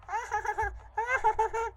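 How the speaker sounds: high-pitched staccato laugh in two bursts; high cackle. Real, not acted. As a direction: cackle high in short, rapid pulses. Laughs after teasing someone will shout. (matinis na halakhak)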